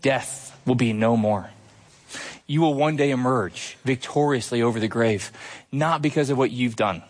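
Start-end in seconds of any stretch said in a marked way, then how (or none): none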